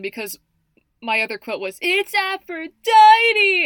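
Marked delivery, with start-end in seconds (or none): 1.8-3.7 much higher and louder, drawn out very slowly; excited exclamation. Retold or imitated. Fan quoting a favourite line, proclaiming it grandly.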